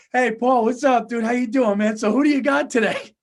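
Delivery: loud, breezy phone hello, imitated; put-on chummy caller, loud; mock friendly greeting. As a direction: launch heartily into small talk.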